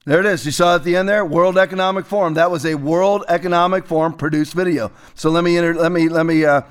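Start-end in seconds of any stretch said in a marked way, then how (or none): none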